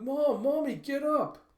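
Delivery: loud, very high pleading; desperate child's cry. Retold or imitated. Child grips her mother's ruined hand, crying desperately. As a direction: cry out, imploring her to move.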